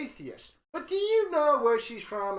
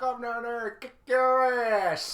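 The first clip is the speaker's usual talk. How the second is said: Roy D. Mercer threat; 6 semitones higher, 3 dB louder than usual. Retold or imitated. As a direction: bark the threat like a hammed-up country prankster. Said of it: "He would be like".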